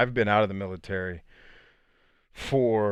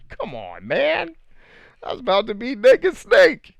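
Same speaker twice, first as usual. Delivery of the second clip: high, loud comic lament; hammed-up protest, pitch jumping way up; mock dismay; 9 dB louder, slower than usual. Actor lamenting his lost Naked Snake role, mock dismay.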